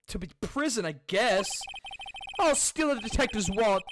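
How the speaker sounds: high hammed-up wail easing into self-pity; mock-tearful lovesick grumbling; melodramatic whiner. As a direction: lament your luck like a comic loser. Voices lovelorn game character lamenting.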